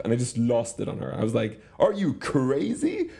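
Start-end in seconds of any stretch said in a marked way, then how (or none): 1.8-3.1 high, slow mock outburst; losing it. Retelling snapping at his daughter over the toy, exasperated. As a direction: blow up at the eight-year-old. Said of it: "I just completely lost it".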